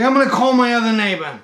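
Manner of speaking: high, loud mock-panicked voice; retold hysterical outburst; frantic announcement. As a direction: imitate a worked-up customer. Hysterical customer on phone.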